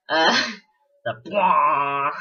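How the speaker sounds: slow drawn-out mechanical blare; imitated Reaper noise. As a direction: blare it like an alien warship. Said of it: "it's basically just the Reaper noise".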